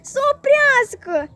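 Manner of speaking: high, loud, slow wailing order; bawling demand. A narrator's reading. Goe yells for the train to stop, bawling. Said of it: "zbiară și mai tare Goie".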